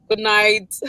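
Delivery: normal talking voice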